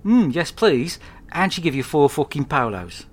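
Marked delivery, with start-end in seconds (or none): 0.0-1.0 put-on eager child. Child answering nan.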